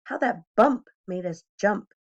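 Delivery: ordinary delivery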